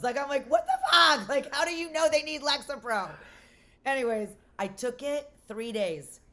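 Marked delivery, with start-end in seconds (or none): 0.5-3.1 loud, high comic outrage; incredulous outburst. Retold or imitated. Patient questioning babies needing Lexapro, incredulous outburst. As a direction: burst out, then keep ranting higher.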